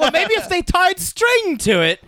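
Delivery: high, slow character voice; mock guard. Quoting a Holy Grail guard to friends, high and slow. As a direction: muse it out slowly like the guard.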